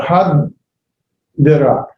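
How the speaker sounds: hadira drawn out slowly; slow demo. Shown as a demonstration. Teacher sounding out the word slowly. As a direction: stretch each syllable of hadira. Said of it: "So that would be".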